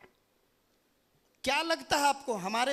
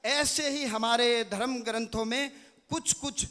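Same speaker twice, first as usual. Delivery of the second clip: usual speaking voice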